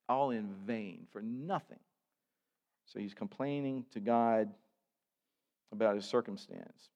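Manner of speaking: usual speaking voice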